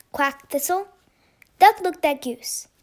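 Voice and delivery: usual speaking voice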